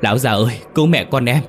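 high, loud, desperate plea; crying for help. A narrator's reading. Mistress flees the ghost calling her husband, desperate cry for help. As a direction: call out in rising panic to be saved. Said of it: "Vừa chạy bà vừa kêu cứu".

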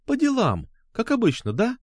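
put-on high, needling girl's voice; mocking echo. A narrator's reading. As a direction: throw his excuse back at him in a high voice. She throws his words back. (язвительно, передразнивая)

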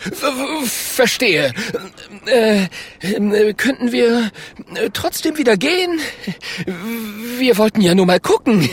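very high, flustered stutter; stammering plea. A narrator's reading. Klonk asks the bear to let them go, stammering. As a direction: plead nervously, tripping then rushing, with a weak laugh. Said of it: "stottert Klonk".

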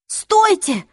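high-pitched cry to stop; shout. A narrator's reading. Clary bursting from behind a column, shouting. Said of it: "закричала Клэри".

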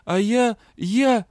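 loud, high sputtering start; sputtering indignation. A narrator's reading. Captain sputtering back, loud indignation. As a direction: choke on outrage. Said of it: "Верис тоже была возмущена".

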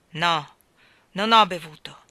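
loud, stressed no; indignant denial. A narrator's reading. Miller angrily denying he is drunk. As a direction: push back hard. Miller denies drinking, furious.

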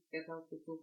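plain reading voice

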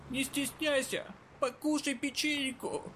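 high, slow creepy granny voice; insistent hoarse old lady; raspy old woman. Acted. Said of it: "снова прозвучал старческий голос".

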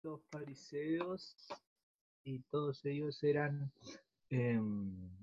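faint, distant voice on the line; sounding far from the mic; phone held away. Real, not acted. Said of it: "se te oye muy lejos".

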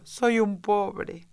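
humble plea. A narrator's reading. Hatter kneeling, pleading to the king.

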